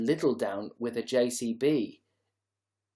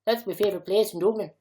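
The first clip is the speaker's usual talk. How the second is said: higher, quick Dublin accent; 5 semitones higher, 3 dB louder, faster than usual. Shown as a demonstration. Streamer echoing an accent sample, quick Dublin accent. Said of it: "put Dublin accent into".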